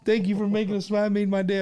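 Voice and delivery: high, teary register lady; cashier's quote. Retold or imitated. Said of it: "She's like".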